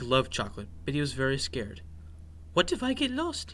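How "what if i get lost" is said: high-pitched, anxious little mouse; worried. A narrator's reading. Scared mouse asking his sister about getting lost, worried. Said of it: "he worried".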